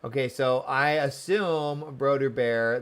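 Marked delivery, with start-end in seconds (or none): none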